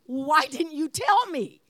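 acted-out wifely exasperation; exasperated protest. Wife demanding why husband never told her, exasperated.

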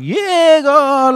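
high, loud, eager yes to God; mock eager yes. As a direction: overeager reply, half joking.

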